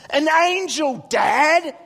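put-on high, loud kid answer; mock amazed exclamation; excited son. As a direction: imitate a boy insisting to Dad. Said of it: "I go".

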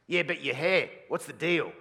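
imitating an older man's blunt question; older guy voice. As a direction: grill him about the hair. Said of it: "they'll say something like this".

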